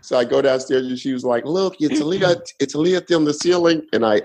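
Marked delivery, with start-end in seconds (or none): 1.4-3.8 high mock Russian accent. Neighbour showing the fire on the ceiling, high mock Russian accent. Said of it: "Okay, that wasn't bad".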